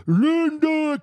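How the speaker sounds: Rocky-style call. Retold or imitated. Big guy calling his wife across the post office, loud and high. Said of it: "Kinda sound like Rocky Balboa".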